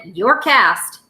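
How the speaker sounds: usual speaking voice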